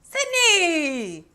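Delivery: imitating reporters shouting; calling out. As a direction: yell her name across a crowd. Reporters calling out her name.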